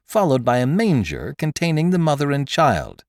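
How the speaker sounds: usual speaking voice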